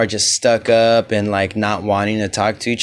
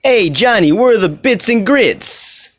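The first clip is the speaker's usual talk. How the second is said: mock Memphis Italian accent; 8 semitones higher than usual. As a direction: drag it out like a country Italian guy. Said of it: "that stupid accent that they have".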